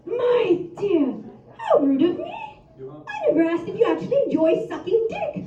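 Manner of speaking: high, prim, proper gentleman voice; posh gentleman. Retold or imitated. She imitates her polite lover in a prim, high gentleman voice. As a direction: play him as a genteel old-fashioned gent. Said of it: "he's like".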